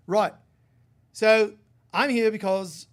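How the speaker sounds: put-on Australian man's accent, high and loud; strong Australian accent. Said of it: "in his strong accent".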